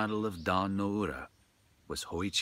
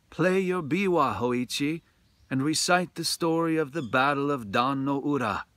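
higher, louder female attendant; court lady's voice; 7 semitones higher, 7 dB louder than usual. A narrator's reading. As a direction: command the musician like a head attendant. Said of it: "A woman's voice".

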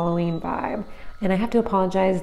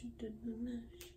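whispering; 4 semitones higher, 24 dB quieter than usual. Pretend. Whispered line of the song.

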